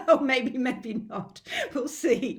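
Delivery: slow, loud words through laughter; amused, laughing it off. Real, not acted. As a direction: still laughing at her own joke. Laughing after the thinner joke.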